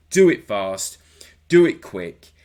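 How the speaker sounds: loud, punched imitation of a wrestler's resolve; urgent self-command. Voicing a wrestler's urgent thinking.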